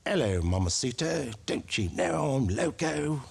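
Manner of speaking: low, slow exaggerated accent; Spanish accent, thick and slow. Shown as a demonstration. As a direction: play it heavy and slow. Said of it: "How was that accent?".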